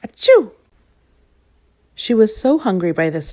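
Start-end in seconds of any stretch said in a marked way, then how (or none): 0.0-0.5 high loud sneeze. Acted. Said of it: "and sneezed again".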